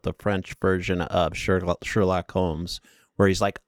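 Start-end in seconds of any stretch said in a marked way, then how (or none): none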